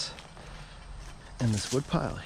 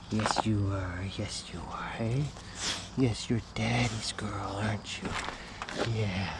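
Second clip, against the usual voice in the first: whispering; 5 dB quieter than usual. Real, not acted. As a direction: whisper sweetly to the dog. Whispered dog baby talk.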